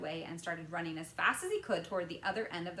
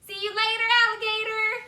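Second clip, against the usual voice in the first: high, loud taunting sing-song; cheeky shout; 16 dB louder than usual. A narrator's reading. Boy taunting everyone as he jumps, high and loud. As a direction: fling it back cheekily. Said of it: "he shouted".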